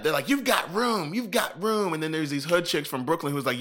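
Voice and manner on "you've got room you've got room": high dismissive mock voice; mocking petty girls. Imitating the rude girls at the barricade, high and snotty.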